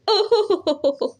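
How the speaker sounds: high, scheming chuckle; a sly, delighted laugh at his own idea; crow laughing. A narrator's reading. Kalu the crow laughing as the idea strikes him. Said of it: "वो हंसने लगता है".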